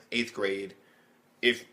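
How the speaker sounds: usual speaking voice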